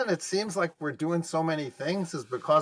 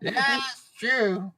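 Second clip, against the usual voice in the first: put-on slow, higher old-timer voice; old person voice; 8 semitones higher, much slower than usual. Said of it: "That was me imitating an old person".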